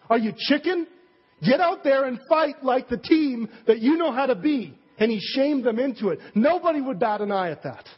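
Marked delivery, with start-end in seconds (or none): 0.1-4.8 higher, pushing coach taunt; rally the team with a challenge; fired-up coach. Retold or imitated. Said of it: "the coach pulls everyone into the dressing room and says".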